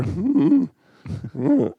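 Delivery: high mock humming, fading down; imitating hums. Podcast host mimics actress's reaction, mock humming. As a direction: hum it like a comic reaction.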